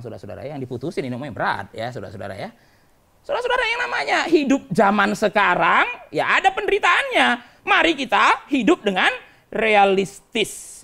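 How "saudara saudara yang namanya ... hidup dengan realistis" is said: loud, high exhortation, slowing to stretched words; full-force preaching. Real, not acted. Preacher urging the congregation to live realistically, full-force preaching. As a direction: proclaim it to the whole congregation, drawing out the end.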